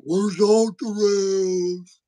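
slow, whiny mock of a critic; mocking complainer. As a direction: drag it out like a nitpicking trad. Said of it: "that's the first thing".

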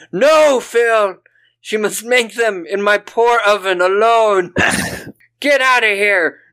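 high, loud mock yell; hammed-up rage at Phil; mock furious mom. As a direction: Susan throwing Phil out. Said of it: "Susan yelling".

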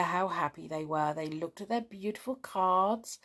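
ordinary delivery

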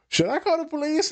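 high mock-alarmed voice; worried friend asks, in a high squeaky voice; alarmed high question. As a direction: squeak it like a startled onlooker.

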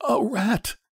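put-on old man's frightened, high-pitched voice; shaking tones. A narrator's reading. Old man answering his startled wife, in shaking tones. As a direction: say it high, trembling with fear. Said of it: "said the old man in shaking tones".

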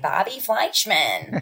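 slow, drawn-out put-on voice for a pushy manager; Fran Drescher impression. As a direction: stretch the name out in a lightly voiced showbiz manager voice. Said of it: "Just Fran Drescher being a manager, being like".

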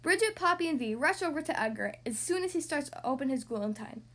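ordinary delivery

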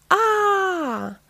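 high, drawn-out "aah"; mummy wail. A narrator's reading. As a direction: let out a long, high mummy cry. Mummy's wordless cry in story.

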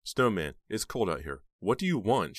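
loud, high impatient demand; loud impatient question. A narrator's reading. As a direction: snap at him to get on with it. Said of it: "she asked impatiently".

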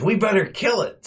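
mock alarmed. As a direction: push it up high, urgent and silly.

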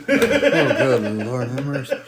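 laughing through the words. Real, not acted. Reacting to the baby brother story, laughing. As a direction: crack up while talking.

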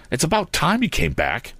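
high-pitched indignant scolding; cross young hero; angry. A narrator's reading. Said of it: "cried Tom angrily".

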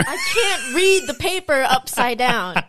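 amused, exasperated objection; laughing protest. Real, not acted. Protests teasing while laughing.

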